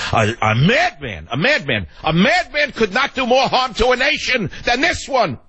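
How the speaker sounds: building from a mutter to a high pitched rant; rising rage. Real, not acted. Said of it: "I went from compassion to rage".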